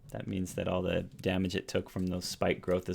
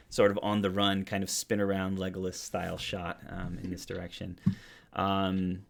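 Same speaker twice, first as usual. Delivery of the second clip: ordinary talk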